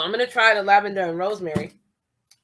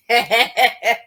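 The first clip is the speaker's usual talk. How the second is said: loud, delighted laugh at her own bargain; proud laugh; 3 semitones higher, 6 dB louder than usual. Real, not acted. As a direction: burst out laughing, pleased with yourself. Laughs after bragging.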